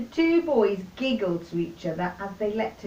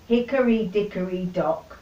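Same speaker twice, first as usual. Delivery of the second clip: plain reading voice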